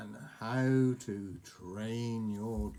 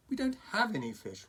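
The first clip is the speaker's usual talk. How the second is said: higher, strained through gritted teeth; losing patience with a pet; 10 semitones higher, 3 dB louder than usual. Acted. Hiccup repeating himself to the dragon, gritted. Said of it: "between gritted teeth".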